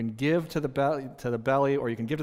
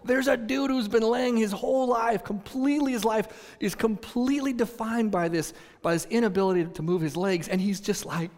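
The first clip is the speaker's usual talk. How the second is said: ordinary delivery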